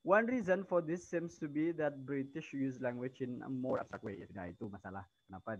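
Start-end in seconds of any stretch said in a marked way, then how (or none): 0.1-4.2 reading aloud. Acted. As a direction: read the textbook line out to the class. Reads English textbook passage aloud.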